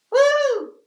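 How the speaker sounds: high, loud party whoop; excited whoop. Real, not acted. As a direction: let out a happy whoop. Cheering at New Year party.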